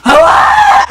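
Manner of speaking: furious scream. Retold or imitated. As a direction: scream it like a kid losing it. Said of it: "I flipped out".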